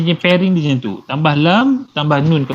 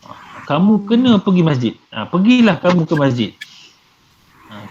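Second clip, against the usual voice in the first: ordinary delivery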